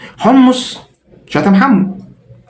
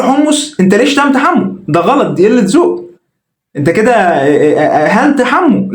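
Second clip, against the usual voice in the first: much higher, scolding; firm rebuke; 5 semitones higher, slower than usual. Retold or imitated. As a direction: tell him off for the insult. What Ayman should tell Hummus. (توبيخ بصوت عالي الطبقة)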